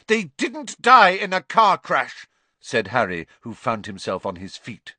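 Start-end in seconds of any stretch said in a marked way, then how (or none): 0.1-2.3 high, loud and defiant; angry denial. A narrator's reading. Boy correcting aunt about his parents, angry. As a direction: contradict hotly, standing your ground. Harry stands up, angrily contradicting aunt.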